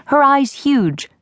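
ordinary delivery

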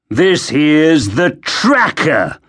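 sneering, slow guard; spat out. Acted. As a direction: spit the word slowly, with scorn. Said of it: "He spit the word".

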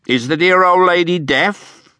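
high, sneering put-on voice; mocking dwarf voice. A narrator's reading. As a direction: sneer at the old lady. Quilp mocks mother-in-law.

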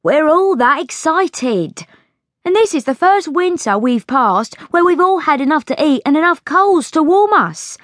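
put-on high-pitched, eager servant girl; excited maid reassuring her friend; excited chambermaid. A narrator's reading. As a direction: gush about the season in a high voice.

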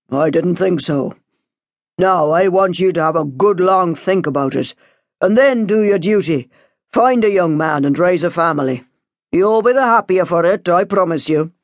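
put-on measured priest addressing a young parishioner; solemn pastoral talking-to; lecturing priest. A narrator's reading. Priest advises the daughter.

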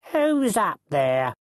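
questioning call from the street; calling up from below. A narrator's reading. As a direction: call slowly up to someone overhead. Voice calls up from below.

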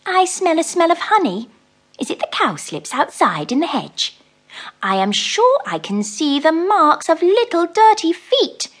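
suspicious mouse. Acted. Mrs Tittlemouse sniffs along the passage, high suspicious mouse voice. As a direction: sniff around like a fussy mouse.

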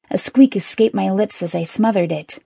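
ordinary delivery, nothing marked

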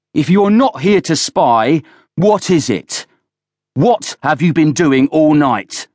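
high, forceful angry questioning; roar. A narrator's reading. Villiers interrogates Oland about the night, roaring. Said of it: "roared Villiers".

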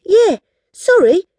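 put-on high, cheeky little girl. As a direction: tease the queen sweetly. Girl addresses queen cheekily.

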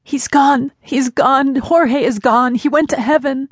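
high, wailing frantic exclamation. A narrator's reading. Caller breaking news of Jorge's death, frantic. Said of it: "exclaimed the frantic caller".

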